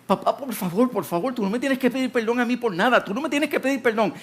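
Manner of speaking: high, rushing plea to a father; pleading protest. Acted. Son protests father's apology.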